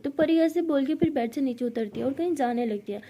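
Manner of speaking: ordinary delivery, nothing marked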